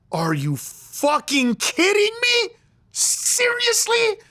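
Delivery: slow, high-pitched outraged disbelief; acted anger. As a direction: stretch out each word in mock-furious disbelief. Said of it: "Yes, I'm angry".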